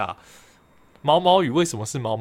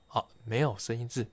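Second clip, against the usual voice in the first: normal talking voice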